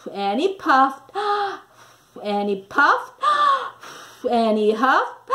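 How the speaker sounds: gasping for breath between puffs, high gasps; huffing and puffing. A narrator's reading. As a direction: puff out hard and gasp for air. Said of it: "So he huffed".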